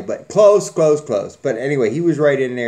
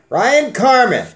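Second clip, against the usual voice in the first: announcing the winner; 10 semitones higher, 7 dB louder, slower than usual. Real, not acted.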